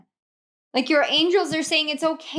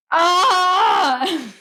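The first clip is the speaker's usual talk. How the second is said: startled cry; 5 semitones higher, 5 dB louder than usual. Real, not acted. Card reveal startles her.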